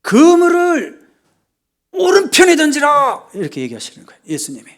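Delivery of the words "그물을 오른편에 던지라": very high, loud, drawn-out call; stretched long-range holler; shouted command. Acted. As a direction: bellow the order slowly across the water. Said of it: "예수님이 또 소리 지릅니다".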